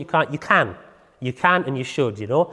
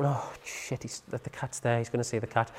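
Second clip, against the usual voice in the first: inner panic; 6 semitones lower, 14 dB quieter than usual. Retold or imitated. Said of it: "straight away you think".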